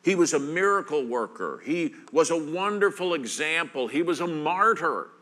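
a lightly voiced dismissive speaker; mild mock of the casual skeptic; what people say. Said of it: "People may tell you".

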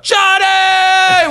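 very high cheering scream. Retold or imitated. Crew in the back cheering for Uncle Johnny, screaming. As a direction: scream his name like a crowd going wild. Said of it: "We're screaming".

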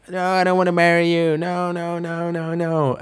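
high-pitched run of frantic refusals; mock going ballistic. Bride refusing to marry the groom, mock ballistic. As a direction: imitate a bride losing it, without shouting. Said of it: "she starts going ballistic".

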